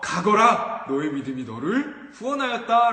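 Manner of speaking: loud, firm blessing; solemn pronouncement. Acted. Jesus declaring to the healed blind man, firm and loud. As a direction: declare it with authority. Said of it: "뭐라고요?".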